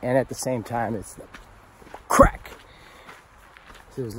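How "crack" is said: sudden loud high "crack." shouted; thunder crack. Retold or imitated.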